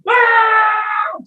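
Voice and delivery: sudden high yelp of fright; startled high scream. Acted. Teacher blown back by the dog's bark, screaming. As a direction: shriek as the bark hits.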